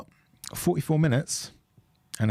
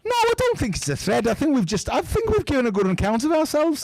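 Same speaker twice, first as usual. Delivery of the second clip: put-on high, whiny Scouse accent; 13 semitones higher than usual. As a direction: whine it out like a Liverpool pundit. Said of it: "keep talking in the Scouse accent".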